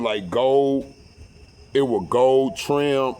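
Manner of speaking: usual speaking voice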